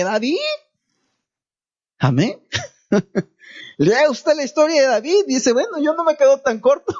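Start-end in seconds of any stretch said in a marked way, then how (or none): 3.8-6.9 higher pitch, talking through a laugh; laughing aside. Real, not acted. Preacher joking with congregation about David, laughing. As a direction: joke it out with laughter in the voice.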